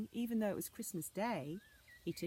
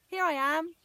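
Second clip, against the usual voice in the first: high, loud answering call; 11 semitones higher, 11 dB louder than usual. Acted. Anna calling to her searching father. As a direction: call back so they hear you. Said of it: "she called".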